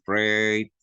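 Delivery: "freight" drawn out slowly; slow, long-vowelled "freight"; diphthong demonstration. Shown as a demonstration. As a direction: stretch the "ay" glide in "freight". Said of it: "we were introduced to diphthongs".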